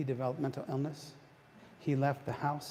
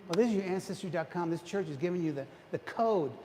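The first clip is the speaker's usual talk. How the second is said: ordinary delivery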